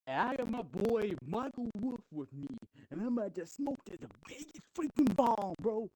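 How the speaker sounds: high, cocky put-on voice for D-Mob; boasting stoner voice. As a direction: brag it out like a stoned tough guy. D-Mob's boast, quoted in the story.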